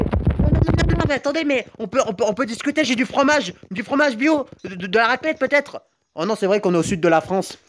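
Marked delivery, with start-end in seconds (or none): 0.0-5.8 high-pitched playful begging; mock panicked pleading. Gamer bargaining with cheese, high mock panicked plea.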